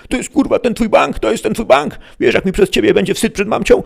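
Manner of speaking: high-pitched, loud squabbling robber; shrieky bickering crook voice; yelling robber. A narrator's reading. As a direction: bawl at your partner in a put-on high voice. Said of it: "wydzierało się na siebie".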